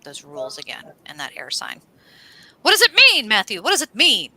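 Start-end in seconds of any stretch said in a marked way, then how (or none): 2.6-4.3 high, loud theatrical question; booming mock-oracle plea; dramatic demand. Real, not acted. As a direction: call on him with mock grandeur. Asking cohost dramatically.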